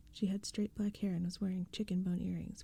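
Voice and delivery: ordinary delivery, nothing marked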